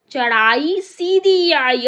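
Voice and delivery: loud, slow dramatic line; dramatic recital. Acted. Teacher reciting the climb line loudly and slowly. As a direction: lean into the steep climb.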